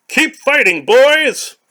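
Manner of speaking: high, loud battle call; shrill rallying cry; rallying shout. Acted. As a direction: spur the boys on, high and loud.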